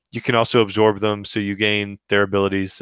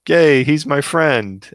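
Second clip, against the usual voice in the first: drawn-out, high, louder happy cheer; excited child; 6 semitones higher, 5 dB louder, much slower than usual. Retold or imitated. Dad imitating his four-year-old who got a game friend, gleeful. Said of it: "he gets a friend, and he's like".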